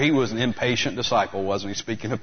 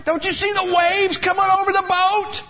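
high, loud desperate wail; panicked cry; 4 dB louder than usual. Retold or imitated. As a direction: wail it out, terrified of drowning. Panicked prayer in the storm.

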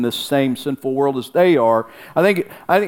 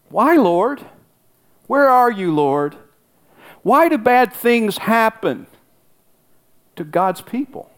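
higher, anguished "Why, Lord?" rising at the end, slowed plea; anguished cry to God; 7 semitones higher than usual. Pretend. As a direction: cry out to God in mock despair, pitched up. Voiced prayer after losing a child.

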